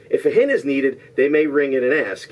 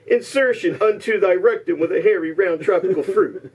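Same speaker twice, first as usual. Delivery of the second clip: higher-pitched theatrical quote reading; mock-Shakespearean declaiming; 7 semitones higher than usual. Host reads contestants a disguised movie line, mock-Shakespearean declaiming. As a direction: declaim it as lofty verse.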